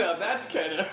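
bursting into loud high laughter; laughing through the words. Real, not acted. Host cracking up at the samurai bit, laughing hard.

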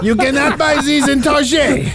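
high, laughing mock French; hammed-up French sales pitch; bad French accent. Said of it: "This is the worst French accent ever".

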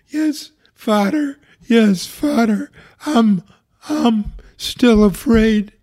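high, slow, tearful pleading; a frightened patient sobbing out each word; crying, barely blurting. Retold or imitated. Hospital patient answering the priest, tearful and high. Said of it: "crying her eyes out and could barely blurt out".

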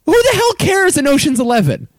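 high-pitched exasperated protest. Real, not acted. Co-host dismissing accent complaints, exasperated. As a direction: argue it high, fed up with the nitpicking. Heated playful argument over accents.

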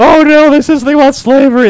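mock panic. Mocking listeners' reaction.

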